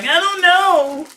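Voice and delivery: high, slow whiny wail; mock whine. Mocking his own complaining to his wife, high slow whine. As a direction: whine it like a helpless kid. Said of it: "And I'm like".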